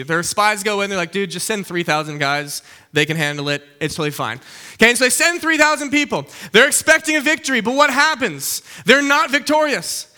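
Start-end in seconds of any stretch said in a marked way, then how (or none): 1.1-4.4 low, offhand dude-speak; acted breezy reassurance; casual overconfidence. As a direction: brush it off, pitched down and easy. Acted overconfident spies shrugging off task.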